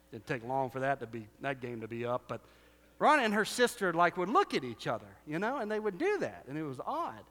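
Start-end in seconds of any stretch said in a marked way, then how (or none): none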